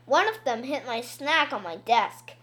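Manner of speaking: plain reading voice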